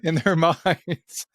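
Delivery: words broken up by real laughter; laughing. Real, not acted. Interviewer clarifying the question to guest, cracking up laughing.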